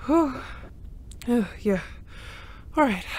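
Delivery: ordinary talk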